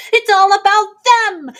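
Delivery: shrill-free high mock lecture; mock indignant defender. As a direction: sneer at the self-righteous crowd. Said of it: "everyone's like".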